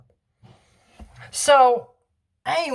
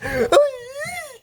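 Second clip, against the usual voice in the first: high squealing laugh; a burst of high, crying laughter. Real, not acted. Kid laughing hard at the story, high squeal.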